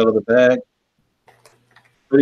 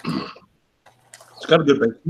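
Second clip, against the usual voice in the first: slow words around a mouthful; chewing as you speak; chewing while talking; much slower than usual. Real, not acted. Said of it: "Trying to talk while I'm chewing".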